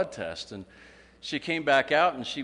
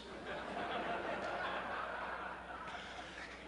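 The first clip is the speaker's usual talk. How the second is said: unvoiced laugh; 12 semitones higher, 12 dB quieter than usual. Real, not acted. Speaker laughs after punchline.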